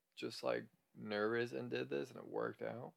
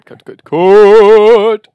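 loud slow calling; 10 semitones higher, 33 dB louder, much slower than usual. Real, not acted. Calling cut to the editor.

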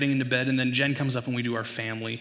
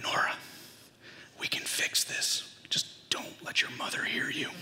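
urgent hushed whisper through a door; 7 dB quieter than usual. Acted. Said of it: "I bend down and I whisper".

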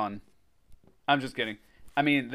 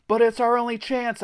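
high, loud desperate wail; 10 semitones higher, 5 dB louder than usual. A narrator's reading. Narrator begs the boys to help, wailing. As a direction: wail a plea. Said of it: "I wailed".

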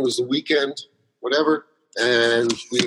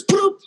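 high mock download beep. Download sound in retold movie.

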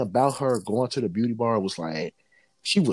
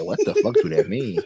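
laughing disbelief; 4 dB louder than usual. Retold or imitated. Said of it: "I said".

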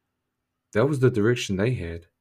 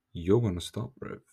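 ordinary talk

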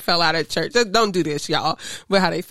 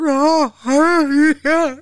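mock crying worship; 7 semitones higher, 5 dB louder, much slower than usual. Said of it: "Start crying and be like".

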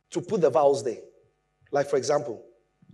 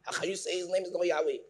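mock scoffing; 7 dB quieter, faster than usual. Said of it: "some of you have already blocked me".

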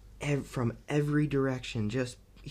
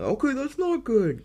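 much higher, louder cartoonish extra voice; extra's line; 12 semitones higher, 6 dB louder than usual. Reviewer mimicking a panicking extra, high and loud. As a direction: squeak it out like a panicked bit player.

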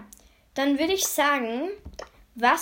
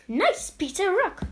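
ordinary talk

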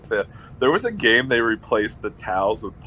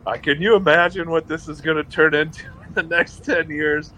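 higher, amazed, half laughing; giddy disbelief; 7 semitones higher than usual. Retold or imitated. Fan imagining a decade of titles, giddy and amazed. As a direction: marvel at the future, laughing a little. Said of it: "I'm like".

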